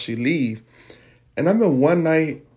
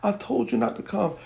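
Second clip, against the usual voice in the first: higher-pitched, mildly upset reproach; retold tearful reproach; 9 semitones higher, 4 dB quieter than usual. Mother crying at home with child, retold tearful reproach. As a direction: reenact a crying mother gently scolding. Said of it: "we was just sitting there crying".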